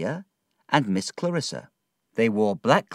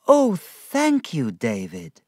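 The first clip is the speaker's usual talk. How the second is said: much higher, slow, thrilled "Oh"; delighted cry; 5 semitones higher, 3 dB louder, slower than usual. Acted. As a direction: gush with delight over a present. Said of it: "she cried".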